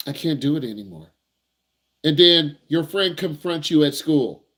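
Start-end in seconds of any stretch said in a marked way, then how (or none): 0.1-1.1 much softer, lower, beaten-down line; defeated. Retold or imitated. Driver finally admits defeat to himself, quiet and defeated. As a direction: say it like you've given up. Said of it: "you pause and you're like".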